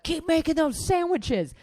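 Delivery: high, loud imitated holler; retold yell. Departing mother to the sandwich makers, mock yell. Angry customer yelling back from door.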